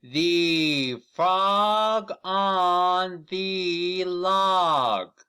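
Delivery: very slow, high and loud, each word stretched; slow model reading. Shown as a demonstration. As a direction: read it out slowly for children to copy. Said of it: "Read it with me".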